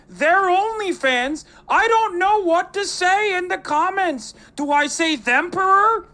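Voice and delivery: high, loud, teary mock whine; whiny crybaby Peterson impression. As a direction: ham up a weepy Peterson on the verge of tears. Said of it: "Always on the verge of tears".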